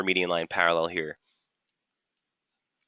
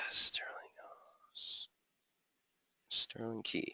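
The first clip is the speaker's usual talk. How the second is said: muttering to self; 18 dB quieter, slower than usual. Real, not acted. Muttering pair names while checking.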